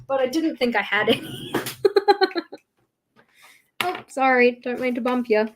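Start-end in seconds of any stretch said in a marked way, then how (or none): 1.6-2.6 bright laugh. Real, not acted. Laughing at own mistake.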